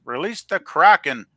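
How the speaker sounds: hammed-up dramatic call; mock command. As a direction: bellow it as a playful order. Performing a famous line from chat.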